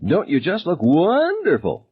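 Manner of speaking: high, slow gloating singsong; high drawn-out sneer; taunting bully. A narrator's reading. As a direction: savour every word of the mockery.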